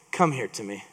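calm invitation. Retold or imitated. Elijah calling the people close.

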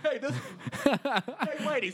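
laughing shout-out. Real, not acted.

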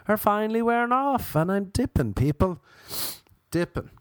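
cracking, fading voice. Real, not acted. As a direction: voice cracking up high. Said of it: "my voice been gone".

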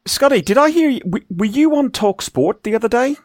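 a high, put-on Northern English accent; mock Northern accent. Host teasing a co-host about a radio spot, mock Northern accent. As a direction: tease him in a jokey Northern accent. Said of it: "trying to do Northern".

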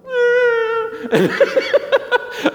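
very high, loud mock shriek breaking into laughter; imitated toddler scream. Four-year-old answering dad's offer of milk, imitated scream. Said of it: "Sometimes he'll be like".

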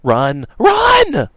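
loud high shouted warning; screaming. A narrator's reading. Radix screaming to his friends in the kitchen to run. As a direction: scream at them to flee. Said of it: "screaming at his two cohorts".

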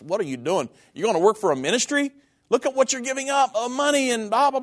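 high mocking disbelief; scoffing relatives. Uncle and brother deride him quitting for ministry, mock scoffing voice. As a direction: mimic them scoffing at a foolish choice. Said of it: "they just thought I was the stupidest".

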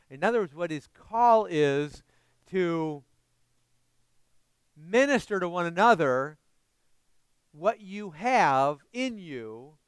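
loud, slow pulpit exhortation; full-force preaching. Acted. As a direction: drive the call home to the congregation.

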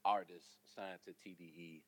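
usual speaking voice

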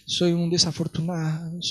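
slow, soft mock wallowing; quiet, drawn-out woe-is-me imitation; self-pitying. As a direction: act out a poor-me lament, dragging the words. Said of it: "hasta autocomiseración".

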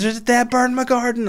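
very high squeaky caricature; silly put-on voice. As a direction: squeak it out like a cartoon lady. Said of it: "why I've done that voice".

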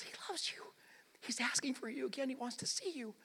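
hushed, half-whispered pleading; tender, very soft reassurance; loving. Retold or imitated. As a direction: softly coax a sulking sister. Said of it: "the love in Martha's voice".

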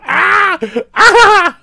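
high mock yells, laughing; hammed-up far-off screams; distant mock screams. Host mimics screams heard in the distance. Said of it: "in the distance going".

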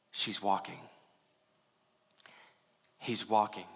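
low, soft, gentle assurance; hushed and deep, with unhurried pauses; tender quiet pride. Acted. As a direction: say it softly, like a loving father looking on. Jesus tenderly watching believers stumble.

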